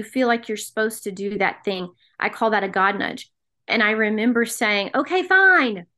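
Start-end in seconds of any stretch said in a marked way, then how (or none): 5.0-5.9 higher-pitched, grudging giving in; stubborn back talk. Retold or imitated. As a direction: re-enact exasperated agreement, starting high. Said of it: "I'm very stubborn and I talk back".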